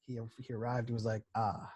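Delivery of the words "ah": lightly voiced weird old man; a hint of a creaky old man. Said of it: "weird old man voice".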